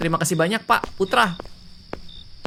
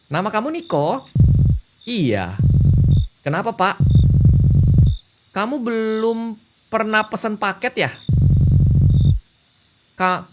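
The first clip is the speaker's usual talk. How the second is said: a bit higher, reading courier and customer lines; lightly voiced game dialogue; 8 semitones higher, 5 dB louder than usual. Courier and customer sort out a wrong address, lightly acted. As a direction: play out the doorstep mix-up lightly in character.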